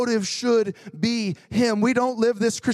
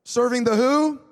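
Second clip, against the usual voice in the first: prompting question. Shown as a demonstration. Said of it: "Would you read those first three words".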